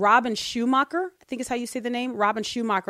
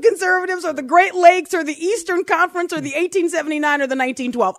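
laughing rant; 7 semitones higher, 8 dB louder than usual. Real, not acted. Laughing rant after joke.

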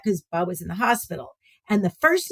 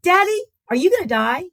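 put-on child's question; 10 semitones higher, 4 dB louder than usual. Son asks his father.

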